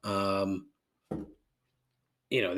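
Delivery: ordinary delivery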